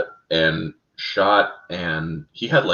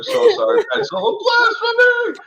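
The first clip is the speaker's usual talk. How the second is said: mock anguish. Real, not acted. Host wailing in mock despair over his own name slip. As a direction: wail it like a hammed-up lament.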